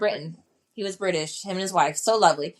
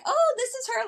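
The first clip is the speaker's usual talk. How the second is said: doctor's wife all happy. Retold or imitated. Wife greeting the injured guest, high sing-song welcome. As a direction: gush a bright hello like a delighted host.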